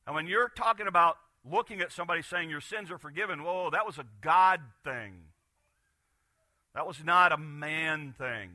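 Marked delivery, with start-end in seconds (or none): 3.4-8.5 slow and loud, stressing "God thing"; emphatic point. Real, not acted. Preacher stressing forgiveness as God's alone. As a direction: drive "God" home slowly. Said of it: "Let me say that again".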